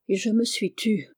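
usual speaking voice

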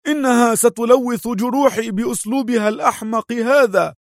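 high, put-on indignant cry; feigned anger. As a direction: protest in a high disguised voice. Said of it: "صاح أدهم متظاهرا بالغضب".

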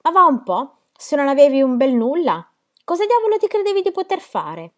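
high, loud mocking exclamation; amused jeering. A narrator's reading. Winning card player mocking the beaten bluffer, amused jeering. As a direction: crow over the loser's empty bluff. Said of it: "Anche Ed rise e disse".

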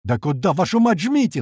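angry cry climbing high; exasperated outburst. A narrator's reading. Pilot snapping at impossible orders, exasperated outburst. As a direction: explode in high-pitched exasperation. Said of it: "взрывается Бат".

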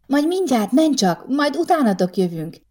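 high call across the room. Acted. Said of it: "kiáltott oda Boginak".

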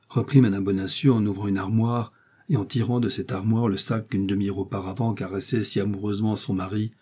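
plain reading voice

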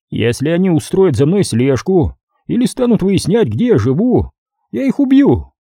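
a hint of a threatening hitman laying down terms; menacing hitman. A narrator's reading. Hitman threatening his boss over the phone, lightly voiced.

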